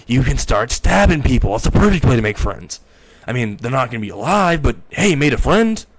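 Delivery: loud, perky pitch for mayhem; gleeful urging. Acted. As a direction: cheerfully egg him on. Chucky gleefully urges stabbing.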